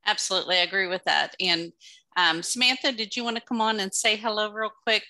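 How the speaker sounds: usual speaking voice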